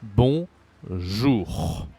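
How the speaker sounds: slow, split "Bonjour" with nasal on; repeat-after-me model. Shown as a demonstration. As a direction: say "Bonjour" syllable by syllable for a learner. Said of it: "Say again".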